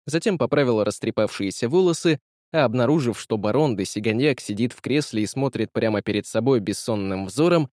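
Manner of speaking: usual speaking voice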